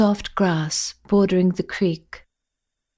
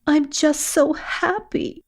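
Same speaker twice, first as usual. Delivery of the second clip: higher, slow, teary happiness; half-crying with joy; sniffling relief; 7 semitones higher, 3 dB quieter than usual. Acted. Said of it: "you sniffle".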